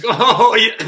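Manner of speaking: exaggerated high, loud realization; playful teasing. Neighbor toying with the woman next door, playful teasing. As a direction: pretend it has only now hit you. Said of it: "you can tell in his voice".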